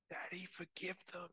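hushed, breathless whisper to the Father; whispered plea. Retold or imitated. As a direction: whisper it like a child begging. Whispered plea contrasting loud one.

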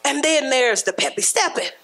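ordinary talk, nothing marked

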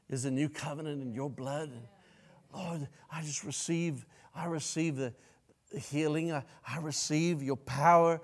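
soft, hushed morning prayer; quiet prayer. Shown as a demonstration. A preacher showing his quiet morning prayer. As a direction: murmur a private prayer to the Lord.

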